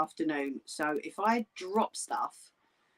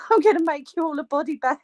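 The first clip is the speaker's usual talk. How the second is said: laughing; 7 semitones higher, 4 dB louder, faster than usual. Real, not acted. Joking through laughter.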